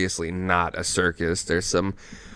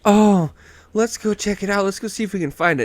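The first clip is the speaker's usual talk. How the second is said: higher-pitched imitation of an eager girlfriend; lightly voiced girlfriend; 9 semitones higher than usual. Imitating girlfriend urging Mike to go look, higher eager voice. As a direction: raise the pitch to mimic her. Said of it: "and she's like".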